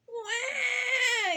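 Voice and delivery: long, high imitated baby cry; storybook baby's "wah"; baby ghost wail. A narrator's reading. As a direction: wail like a hungry infant on one held note. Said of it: "yelled baby ghost".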